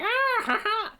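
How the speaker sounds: very high, squeaky gremlin laugh; gremlin cackle. Retold or imitated. Reviewer mimics the video game gremlin creature, high squeaky cackle. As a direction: cackle like a tiny goofy gremlin. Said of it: "gremlin looking creature that's just like".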